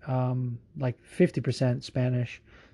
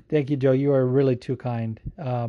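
usual speaking voice

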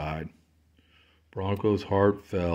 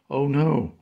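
calling out; 6 semitones higher, 5 dB louder than usual. Acted. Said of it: "Robin called".

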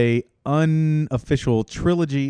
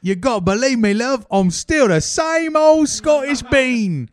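higher pleading fake Scottish accent; hammed-up Scottish plea; 8 semitones higher than usual. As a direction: beg her in a bad Scottish accent. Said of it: "Scottish guy".

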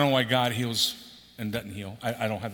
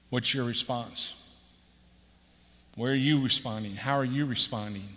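ordinary talk, nothing marked

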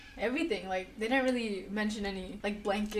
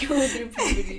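laughing; 7 dB louder than usual. Real, not acted. Finishing the teasing line.

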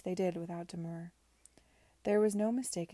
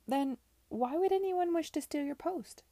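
high, loud incredulous challenge; raised, accusing woman's question; challenging question; 9 semitones higher, 7 dB louder than usual. A narrator's reading. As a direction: press the point, voice rising high. Charlotte challenges the suspect.

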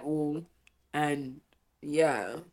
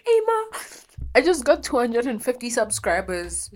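bursting high and loud with news; calling out the good news much higher and louder; excited announcement; 8 semitones higher, 6 dB louder than usual. Retold or imitated. Daughter telling mom about subscribers, thrilled and high.

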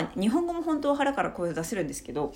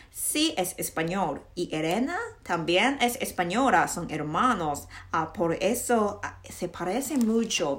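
slow, deliberate textbook Spanish; Spanish dialogue reading; 4 dB louder, much slower than usual. Shown as a demonstration. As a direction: read the dialogue out with rising and falling lilt. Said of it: "もうちょっと読んでみよう".

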